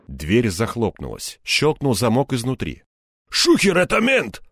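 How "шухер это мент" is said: high, urgent warning; alarmed exclamation. A narrator's reading. Crook warning his gang of a cop, alarmed cry. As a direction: sound the alarm, pitched way up. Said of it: "воскликнул Нос".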